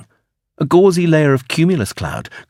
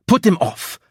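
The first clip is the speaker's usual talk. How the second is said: a high, sharp snap; snapped; 8 semitones higher than usual. Acted. Kendrick cuts off the blonde man, snapping. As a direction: snap the order off short. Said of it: "Kendrick snapped".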